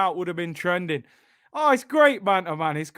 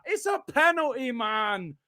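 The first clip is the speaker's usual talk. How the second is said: usual speaking voice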